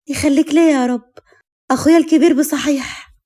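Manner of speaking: put-on excited young cousin's voice; delighted thanks. A narrator's reading.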